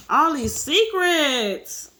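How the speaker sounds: drawn-out exclamation. Pretend. Reader reacts to the story's secrets, long-drawn and swooping. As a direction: draw each word out in a big swooping fall.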